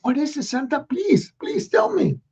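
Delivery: high pleading voice; eager begging. Acted. Ricky begging Santa to reveal the gift. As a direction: beg like an impatient child.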